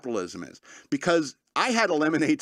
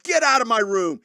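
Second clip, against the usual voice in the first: loud, high angry outburst; retold angry shout; 8 semitones higher, 6 dB louder than usual. Woken man throwing the dog out, angry shout. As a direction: yell it like you're furious at the dog. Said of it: "and you're mad".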